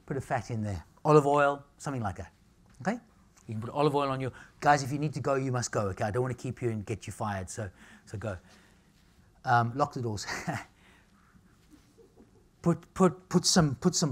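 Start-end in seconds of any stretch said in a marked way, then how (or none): none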